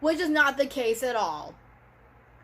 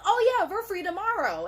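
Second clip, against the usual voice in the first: mocking office staff; 6 semitones higher than usual. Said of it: "They just automatically assume".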